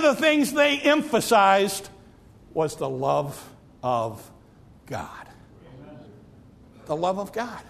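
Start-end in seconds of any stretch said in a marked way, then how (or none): 2.5-7.6 drops low and soft, slow, almost breathed away; softly savouring the love of God; hushed. Acted. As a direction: let the love of God fade into a near hush. Sinks to hush on love.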